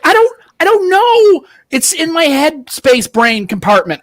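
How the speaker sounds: high, slow, flustered mock excuse; flustered mock reply. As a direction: play dumb, high and drawn out. Flustered reply to confused guy.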